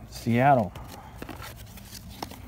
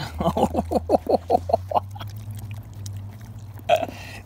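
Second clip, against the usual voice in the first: breathless silent laugh; 10 semitones higher, 5 dB quieter than usual. Real, not acted. Laughing at the dog.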